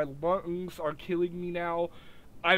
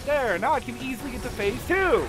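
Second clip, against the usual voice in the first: excited; 4 semitones higher than usual. Real, not acted. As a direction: cheer it out, finally winning. Game triumph.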